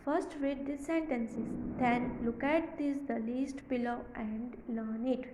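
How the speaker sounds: very slow, word by word reading; slow classroom dictation. Shown as a demonstration. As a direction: read it out slowly for the class. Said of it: "teacher reading instructions".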